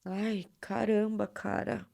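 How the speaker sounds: wiping away real tears; tearful. Real, not acted. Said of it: "pausa pra eu enxugar as lágrimas".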